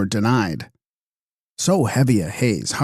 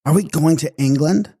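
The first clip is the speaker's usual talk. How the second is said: surprised; 6 semitones higher than usual. A narrator's reading. Elil asks, astonished.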